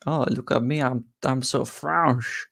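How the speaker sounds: hammed-up, self-mocking French accent; cartoon French accent, drawn out at the end; fake French accent. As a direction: show off with a silly French accent. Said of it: "that is my fake French accent".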